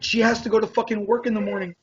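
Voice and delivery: high, incredulous outrage; texting the girl, high incredulous outrage; indignant. Retold or imitated. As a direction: push back, voice jumping up. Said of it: "And I was like".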